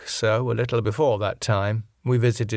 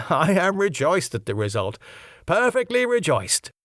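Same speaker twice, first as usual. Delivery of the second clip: chuckling, much higher, delighted; rejoicing laugh; 8 semitones higher than usual. Acted. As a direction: laugh through it, tickled pink. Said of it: "his rejoicing".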